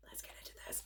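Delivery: hushed whisper. Real, not acted. About to unwrap the gift, whispered. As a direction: whisper it like a secret before opening.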